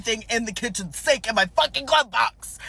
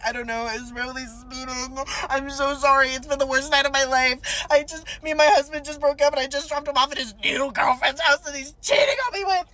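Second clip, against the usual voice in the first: mock sobbing; 5 semitones higher than usual. Driver word vomiting at poor cop, mock sobbing. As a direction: pour it all out in hammed-up tears.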